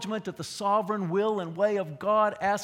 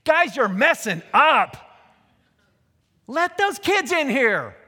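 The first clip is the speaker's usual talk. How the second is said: loud, higher, mad call to the disciples; indignant shout; 7 semitones higher, 10 dB louder than usual. Shown as a demonstration. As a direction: tell them off loudly. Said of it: "kinda like this".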